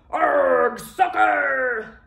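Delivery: pirate yell. Acted. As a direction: yell it kicking the ball. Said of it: "kicked the ball and yelled".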